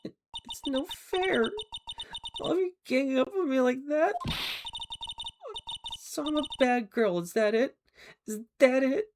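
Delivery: put-on high, petulant girlish voice; Miss April May's whine. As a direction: whine like a cornered bad girl. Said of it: "the real Miss April May".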